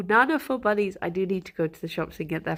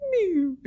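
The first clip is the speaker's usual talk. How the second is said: imitated mew; 10 semitones higher than usual. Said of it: "it's just like".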